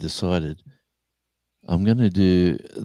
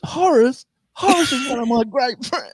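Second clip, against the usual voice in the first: much higher squeal of an amazed workmate; 4 dB louder than usual. Retold or imitated. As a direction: play the stunned coworker hitting a high squeal. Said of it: "He goes".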